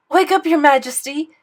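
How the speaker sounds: loud, high wake-up shout. Acted. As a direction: yell to rouse the sleeping king. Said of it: "he shouted".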